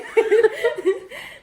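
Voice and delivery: bursting into a high laugh; big real laugh, loud and high. Real, not acted. Laughing at gagging story.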